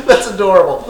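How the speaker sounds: loud, high laugh around the words; big amused guffaw, played up; mocking laugh. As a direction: laugh it off as the scornful halfling. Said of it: "He, he just kinda laughs".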